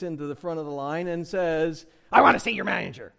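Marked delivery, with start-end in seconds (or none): 2.1-3.1 demanding, fast Karen tone; entitled customer. Retold or imitated. Shopper at the return line demanding the manager, entitled. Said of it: "It's that kind of a tone".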